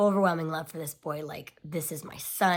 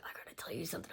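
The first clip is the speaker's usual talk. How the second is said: urgent whisper, much softer; 12 dB quieter, much faster than usual. Retold or imitated. Husband whispering to his wife mid-sermon, urgent whisper. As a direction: lean in and breathe it so nobody hears.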